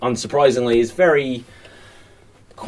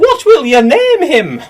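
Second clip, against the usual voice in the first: high nurse voice; 12 semitones higher, 8 dB louder than usual. Acted. Nurse voice in scene.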